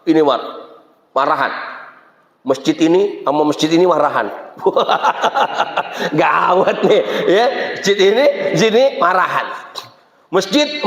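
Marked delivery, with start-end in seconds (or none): 4.6-6.1 breathy, drawn-out chuckle; amused laugh. Real, not acted. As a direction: laugh at the absurdity of it. Laughs at feuding mosques. (tawa geli)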